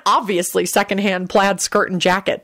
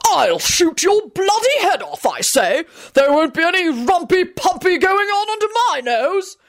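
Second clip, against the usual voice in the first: high, outraged bluster; high-pitched, drawn-out acted tirade; blustering bellow; 12 semitones higher than usual. A narrator's reading. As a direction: rant like an affronted husband. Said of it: "Richard was bellowing at the top of his lungs".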